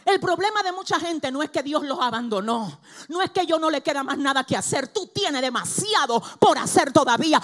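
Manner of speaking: loud, high, driving sermon voice; a fiery preacher rousing the congregation; full-force preaching. Acted. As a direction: preach it hard to the whole room.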